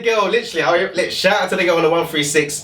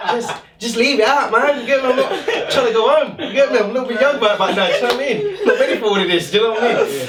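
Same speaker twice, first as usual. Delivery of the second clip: higher, laughing nervous pleading; laughing plea; 10 semitones higher than usual. Retold or imitated. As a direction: beg her off half laughing.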